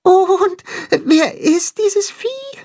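very high, slow little voice; small animal asking innocently; very high slow child-like question; high puppy voice. Acted. Tacho asks.

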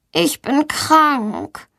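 plaintive, laboured complaint; suffering voice. A narrator's reading. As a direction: whimper pitifully like a sick child. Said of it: "mit leidender Stimme".

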